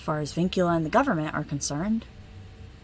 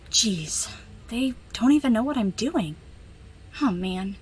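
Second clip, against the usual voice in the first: ordinary talk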